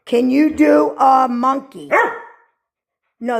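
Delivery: ordinary delivery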